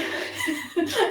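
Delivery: voiceless rising giggle; breathy laugh. Real, not acted. Teacher laughing at herself mid-class. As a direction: let a breathy laugh escape.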